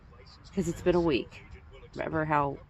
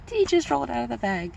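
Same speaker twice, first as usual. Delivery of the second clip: higher and louder, giggling through the words; laughing at own pun; 7 semitones higher, 5 dB louder than usual. Real, not acted. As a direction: crack yourself up as you repeat the pun. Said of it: "Get it?".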